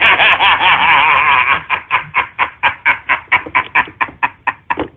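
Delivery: long deep villain cackle; evil cackle. Acted. Streamer mocking trolls with an evil cackle. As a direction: cackle like a mad overlord.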